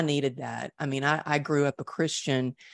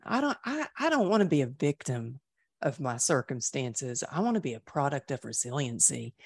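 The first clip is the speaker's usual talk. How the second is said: usual speaking voice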